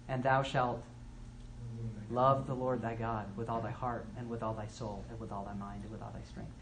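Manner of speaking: soft, hushed reading of the commandment; solemn scripture recitation. Acted. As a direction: intone it reverently, almost to a whisper. Reciting Deuteronomy six five.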